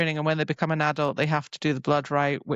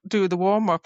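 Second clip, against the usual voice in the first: ordinary delivery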